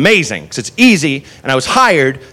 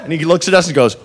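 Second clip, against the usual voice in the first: usual speaking voice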